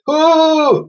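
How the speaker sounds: very high, loud distressed roar; Wooly trapped in the net; mammoth roar. Acted. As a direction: roar in panic. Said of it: "roared Wooly".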